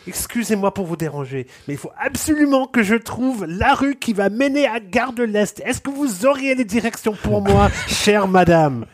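high, florid, over-courteous request; high mock-refined Parisian asking directions; hyper-polite French. Shown as a demonstration. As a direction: lay the courtesy on thick, high and sweet. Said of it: "I'd be like".

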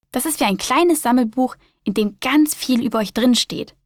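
lively storybook reading voice; reading to children. A narrator's reading. Host reading a spider story to young listeners, lively storyteller voice. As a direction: read it like a bedtime picture book.

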